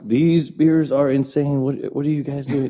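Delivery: lightly hammed-up British accent, slow; terrible British accent. Bartender imitating British customers in a mild British accent. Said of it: "That's my terrible accent".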